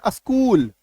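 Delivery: अस्कूल with an extra "a" before the s; students' mispronunciation. Retold or imitated. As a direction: imitate learners saying a-skool for अस्कूल. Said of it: "वो क्या बोलते हैं". (आगे "अ" जोड़कर गलत उच्चारण)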